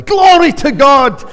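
loud, high, slow shout of praise; shouted glory to God; imitating a shouted praise. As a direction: throw it out like a Pentecostal preacher. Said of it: "The whole place erupted".

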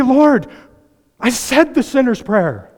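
high, loud pleading cry; pleading sinner. Retold or imitated. Sinner on judgment day pleading with the Lord, high and loud. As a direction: beg the Lord desperately. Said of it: "people who are gonna stand before God".

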